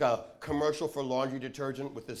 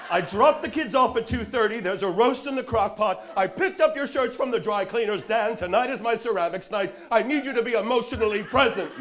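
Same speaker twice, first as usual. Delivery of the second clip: higher, louder frazzled nag; exhausted New Jersey housewife; 6 semitones higher, 6 dB louder than usual. Pretend. Man bun voiced as housewife nagging Dan, higher louder. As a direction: rattle off chores like a worn-out housewife. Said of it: "like an exhausted New Jersey housewife".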